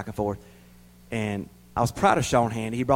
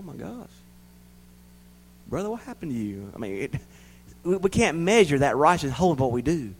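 normal talking voice

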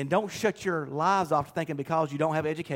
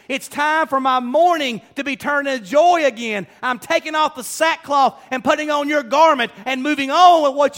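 full-force preaching; 7 semitones higher, 8 dB louder than usual. Acted. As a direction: shout the church forward.

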